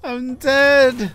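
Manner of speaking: high, loud whine of dismay; lamenting. Real, not acted. Lamenting the game death.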